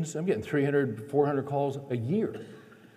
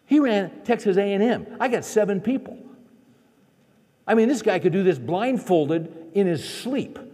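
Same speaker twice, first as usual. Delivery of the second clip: high, loud exasperated argument; incredulous protest; 6 semitones higher, 5 dB louder than usual. Retold or imitated. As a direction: argue how absurd your wife's idea is. Acts out incredulous protest to wife.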